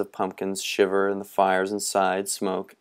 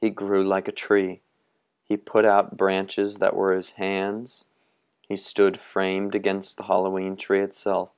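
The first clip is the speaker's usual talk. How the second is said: ordinary delivery, nothing marked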